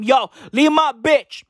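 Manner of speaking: mock threatening tough-guy rapper; hammed-up warning to leave your partner alone. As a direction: imitate a rapper making rambling threats. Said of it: "making threats to [name]".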